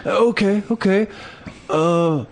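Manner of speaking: high, stumbling voice of a caught squatter; stammering drunk guy. Retold or imitated. Guy pretending to leave.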